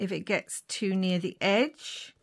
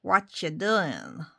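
hammed-up old-time American gangster accent; badly faked American mobster drawl, drawn out; 1920s American gangster; 5 dB louder, slower than usual. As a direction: put on a slow, cheesy American gangster accent. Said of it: "sounds like a gangster from the nineteen twenties".

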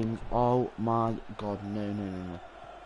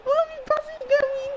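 very high, loud disbelieving shriek; shrieking laughing question; incredulous squeal; 7 dB louder than usual. Real, not acted. Incredulous at own play.

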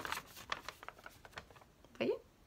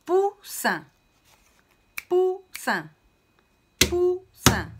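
Poussin scandé fort et haut, pou-ssin en deux coups; syllabes frappées; 11 semitones higher, 8 dB louder, much slower than usual. Shown as a demonstration. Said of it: "on peut frapper deux fois dans la main". (maîtresse qui scande Poussin en deux frappes pour les élèves)

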